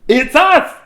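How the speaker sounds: very high, loud shout; penguin siblings. A narrator's reading. Brothers and sisters calling to Dudley, high excited shout. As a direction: sing out loudly at the door.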